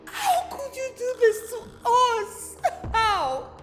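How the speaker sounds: crying. Acted. Crying at husband.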